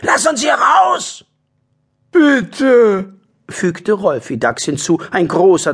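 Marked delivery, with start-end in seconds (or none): 0.0-1.2 slow, high shout; calling out. Acted. Harry the badger calls from the prison, high shout. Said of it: "rief Harry Dax". 2.1-3.1 high, loud, altered voice; pleading badger voice. Rolfi the badger begs to be let out, high plea.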